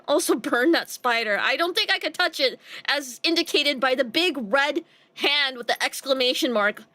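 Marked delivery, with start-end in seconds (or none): none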